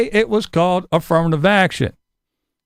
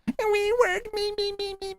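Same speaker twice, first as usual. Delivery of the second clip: much higher, fading to very soft whine; whiny mimic; 10 semitones higher, 9 dB quieter than usual. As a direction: whimper me me me like a child. Mocking whining liberal.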